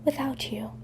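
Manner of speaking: soft unvoiced whisper. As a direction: breathe the words barely aloud. Confession in a romance scene.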